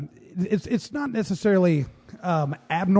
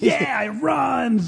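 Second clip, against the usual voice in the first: excited; 8 semitones higher, 4 dB louder, much slower than usual. Retold or imitated. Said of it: "So we're like".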